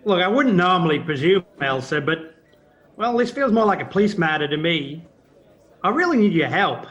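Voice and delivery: pressing hard and loud for help; urgent loud plea. Acted. Embassy man presses the inspector, loud and urgent. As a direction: raise the voice, insist he must help.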